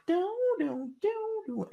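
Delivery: high sung "do, do" imitating a piano; sung piano notes. Imitating the weird piano on the track, sung high. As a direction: hum the piano line up high. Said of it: "you just hear this weird piano".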